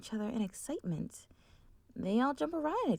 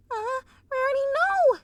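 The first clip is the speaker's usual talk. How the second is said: high, loud cry of alarm; yelling; 14 semitones higher, 11 dB louder than usual. Acted. Sweetie Belle seeing her sister in the street, loud high cry. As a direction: scream it in a panic. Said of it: "she yells".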